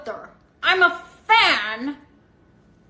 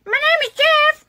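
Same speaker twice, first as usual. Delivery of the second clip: meme voice; 11 semitones higher, 3 dB louder than usual. Acted.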